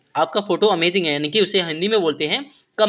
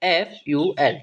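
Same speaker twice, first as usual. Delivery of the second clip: letter by letter; much slower than usual. Shown as a demonstration. Teacher spelling a new word for students, letter by letter. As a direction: spell it out slowly. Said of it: "एक एक letter की बात करते हैं".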